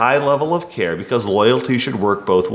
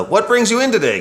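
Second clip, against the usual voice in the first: higher, a hint of mocking the cliché; mock salesman line; 11 semitones higher than usual. The narrator mocks the stock sales question, pitched high. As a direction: send up the stock greeting. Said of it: "Sales technique tells us".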